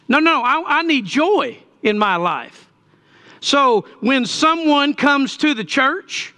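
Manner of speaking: high-pitched, driving sermon delivery; fervent preaching. Real, not acted. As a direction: preach it to the congregation with urgency. Preaches to congregation at raised pitch.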